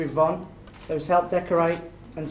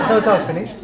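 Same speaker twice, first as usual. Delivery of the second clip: laughing; 4 semitones higher, 7 dB louder than usual. Real, not acted. Laughing reply to heckle.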